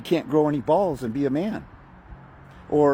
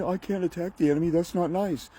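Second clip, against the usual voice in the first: meek excuse; 7 semitones higher than usual. Retold or imitated. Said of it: "And you're saying".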